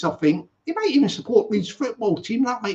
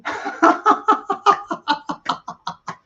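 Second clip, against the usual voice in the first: high cackle; 13 semitones higher, 3 dB louder than usual. Real, not acted. High laugh after Isa joke.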